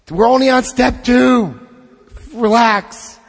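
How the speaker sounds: high, loud, jokey exasperation; exasperated reassurance. Real, not acted. Speaker tells doubters it's okay, exasperated reassurance. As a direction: call out to calm the room.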